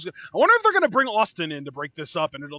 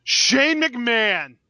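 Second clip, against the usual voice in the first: shocked; 5 semitones higher, 6 dB louder, much slower than usual. Real, not acted. Shocked reveal of Shane.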